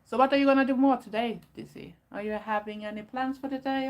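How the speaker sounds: bright, fast sing-song question to a pet; pet talk. Real, not acted. Asking her pet Dizzy about its plans in cooing pet talk.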